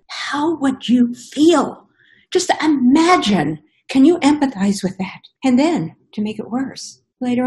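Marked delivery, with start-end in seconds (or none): 0.1-5.2 real grief breaking through the question; pleading with the audience while close to crying; choked up. Real, not acted. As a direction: voice catching, near tears. Grieving speaker asks audience.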